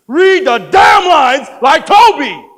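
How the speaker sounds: slow, high, roaring order; furious shout. Acted. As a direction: bellow each word in fury. Director loses patience.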